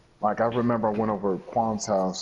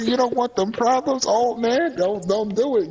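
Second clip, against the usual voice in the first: high mock warning to an old man; high, playful retold threat; 13 semitones higher, 3 dB louder than usual. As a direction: warn him off, hammed up and high. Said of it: "I said".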